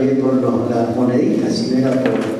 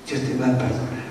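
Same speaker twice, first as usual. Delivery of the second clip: much softer, gentle promise; soft assurance; 2 semitones lower, 8 dB quieter, faster than usual. Real, not acted. Preacher gently promising forgiveness to the congregation, soft and quiet. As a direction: say it quietly, like a comfort.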